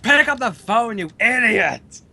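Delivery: angry phone demand. Pretend. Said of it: "will do any message you want for your answering".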